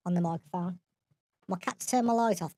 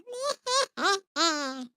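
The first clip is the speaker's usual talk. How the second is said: put-on high-pitched baby giggle; 11 semitones higher than usual. Shown as a demonstration. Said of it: "the baby voice".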